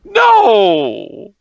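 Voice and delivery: high, loud hammed-up cry of no; a mock-hurt yelp; mock protest. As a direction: play the wounded innocent. Protests the knife-twisting charge.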